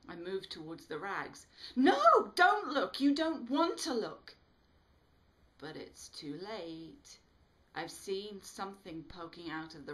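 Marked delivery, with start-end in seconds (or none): 1.8-4.4 very high, loud panicked cry; frantic warning. A narrator's reading. Smitty warning Bobby in panic not to look. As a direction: desperately stop her from looking.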